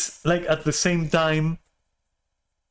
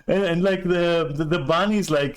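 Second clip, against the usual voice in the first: ordinary delivery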